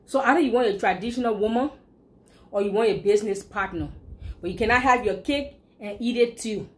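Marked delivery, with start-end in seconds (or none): none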